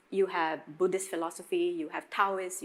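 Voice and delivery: usual speaking voice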